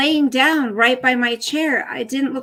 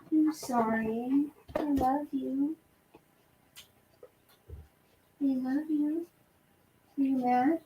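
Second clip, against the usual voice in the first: hushed cooing; 3 semitones higher, 12 dB quieter than usual. Real, not acted. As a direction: murmur tender love to the dog. Cooing softly to her dog.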